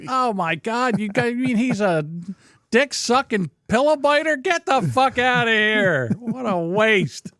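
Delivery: high-pitched appalled disbelief; mock shocked dismay. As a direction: react aghast, slowing into a lament. Acting shocked reaction to Rock Hudson.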